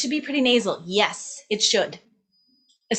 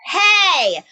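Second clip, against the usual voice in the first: speaking voice, only much louder and higher; calling voice; 9 semitones higher, 9 dB louder than usual. Shown as a demonstration. Teacher shows the right way to belt, loud calling voice. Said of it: "like your speaking voice, only louder".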